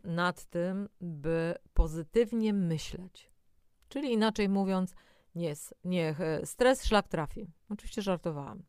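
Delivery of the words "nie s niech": ordinary delivery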